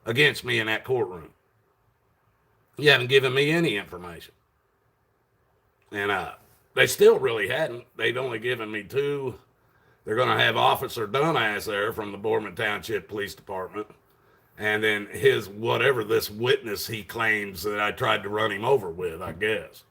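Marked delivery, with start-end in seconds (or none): none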